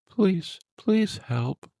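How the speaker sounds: a monster faking a trapped woman's plea, high and whimpering; creature mimicking a woman's whimper. A narrator's reading. Monster mimics the trapped woman to lure them, high pleading whimper. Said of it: "it whimpered, in hers".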